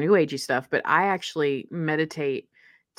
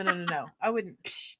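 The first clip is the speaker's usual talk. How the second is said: normal talking voice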